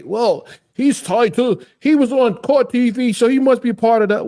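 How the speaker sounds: high-pitched mocking imitation of gossips; mocking accusers. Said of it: "People will say".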